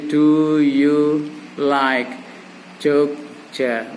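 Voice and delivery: very slow, drawn-out question; slow teaching example, dropping at the end; slow model phrase. Shown as a demonstration. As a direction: spell the question out word by word. Models the full question slowly.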